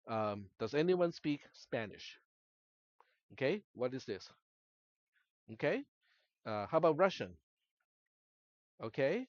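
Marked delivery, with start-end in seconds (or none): none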